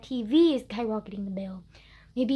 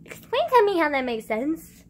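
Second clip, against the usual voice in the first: exasperated demand; 4 semitones higher, 9 dB louder than usual. Real, not acted. Exasperated demand after rant.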